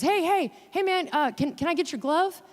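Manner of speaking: high-pitched pestering fan, no real volume; mock yell. Grown fan begging a passing player for a glove, mild mock yell. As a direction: imitate someone calling after a player. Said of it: "he yells out at one of our players".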